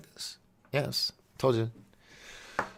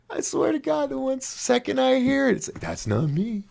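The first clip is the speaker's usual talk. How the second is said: high-pitched, laughing through the words. Real, not acted. Host disowning own funny voice, laughing. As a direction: talk while fighting real giggles.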